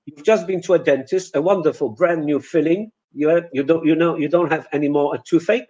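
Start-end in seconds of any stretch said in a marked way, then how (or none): none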